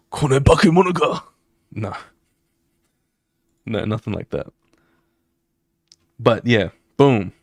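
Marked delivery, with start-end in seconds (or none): 0.1-1.2 lightly voiced anime villain, higher; a quick hint of a dramatic anime villain; classic anime villain line. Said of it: "Will he say the classic".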